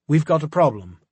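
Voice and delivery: put-on crisp upper-crust British accent over the comm; posh English agent reporting trouble. A narrator's reading. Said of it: "Dante's upper-crust British accent".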